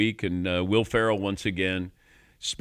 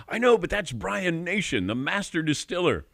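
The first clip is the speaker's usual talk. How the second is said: higher, reverent mock awe; awed local; 9 semitones higher than usual. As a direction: insist he's the real celebrity. Locals correcting him, awed.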